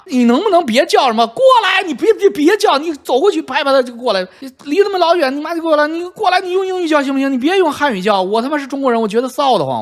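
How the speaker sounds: high-pitched, agitated telling-off; exasperated scolding. Retold or imitated. Telling off a noisy mother at the museum ship, exasperated scolding. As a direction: scold in a high, fast-rising fed-up voice.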